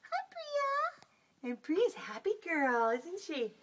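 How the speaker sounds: high drawn-out hi to the kitty; sweet sing-song greeting for a cat; pet talk. Real, not acted. Sitter greeting the cat on video in high pet talk.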